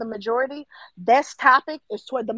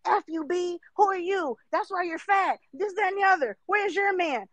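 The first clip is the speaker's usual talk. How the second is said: higher, shrill-free hammed-up rant; mock angry commenter; 7 semitones higher than usual. Angry commenter lashing out at the host, hammed-up mock rant. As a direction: play the hurt commenter snapping back. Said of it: "These comments".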